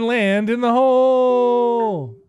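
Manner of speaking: high, loud, stretched-out plea; pleading shout. Real, not acted. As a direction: beg the ball into the hole. Begging ball to drop in.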